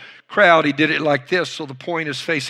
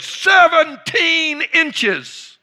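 drawn-out, much higher shout above the crowd; booming shout; 12 semitones higher, 4 dB louder, much slower than usual. Retold or imitated. Old coach tops the roaring room with a slow shout. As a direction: roar it over everyone, very slowly. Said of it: "above them all, he said".

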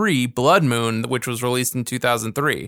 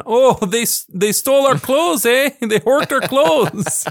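put-on Canadian accent, pitched up, slowed down at the end; mock Canadian; 10 semitones higher, slower than usual. Retelling a movie line in a Canadian accent. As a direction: play up a jokey Canadian accent, eh. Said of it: "he remembered".

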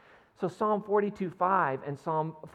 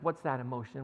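ordinary talk, nothing marked

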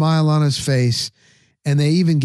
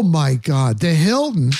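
normal talking voice

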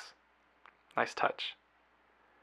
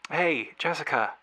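ordinary delivery

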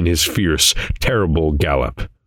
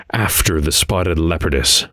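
usual speaking voice

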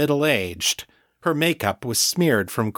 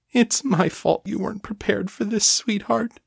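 lightly voiced tearful mother; 10 semitones higher than usual. Held mother voice.

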